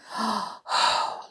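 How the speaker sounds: audible breathy sigh; acted sigh. A narrator's reading. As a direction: sigh out for the characters. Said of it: "Lumiere and Cogsworth sighed".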